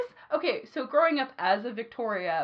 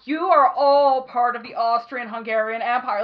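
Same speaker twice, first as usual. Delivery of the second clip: much louder, slow proclamation; teacher's grand decree; 3 semitones higher, 11 dB louder than usual. Retold or imitated. Teacher pointing at a group, loud slow proclamation. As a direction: declare it like a royal edict. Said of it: "and he'd be like".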